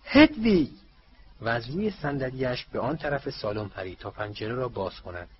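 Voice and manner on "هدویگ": a loud, high call across the room; sudden joyful shout of a name. Acted. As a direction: call out to the owl at the window. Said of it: "او فریاد زد".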